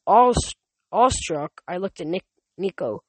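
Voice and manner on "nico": ordinary delivery, nothing marked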